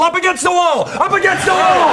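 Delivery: high, loud raid command; shouted police order. Acted. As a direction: bark it like a drugs squad cop. Ex-drug cop waiter raids the table.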